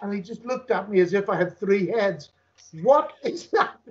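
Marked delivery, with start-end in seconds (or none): 2.8-3.8 baffled question. Retold or imitated. Officer's retold baffled question.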